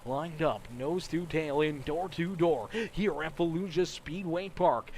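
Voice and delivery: ordinary talk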